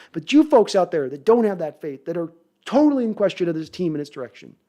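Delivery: ordinary delivery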